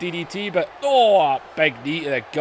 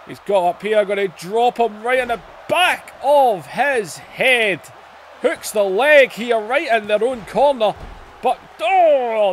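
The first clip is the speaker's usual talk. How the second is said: wrestling commentator; 7 semitones higher, 3 dB louder than usual. Acted. Wrestling play-by-play call.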